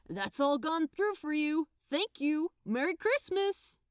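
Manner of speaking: chirpy till voice wishing a merry Christmas; cheery sales assistant. Acted.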